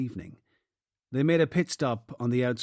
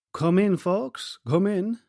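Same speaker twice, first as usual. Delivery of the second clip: put-on welcoming in a broad Cork accent, higher and slow; strong Cork accent; 8 semitones higher, 5 dB louder, slower than usual. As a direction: greet the visitors warmly in thick Cork tones. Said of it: "Donovan said in a strong Cork accent".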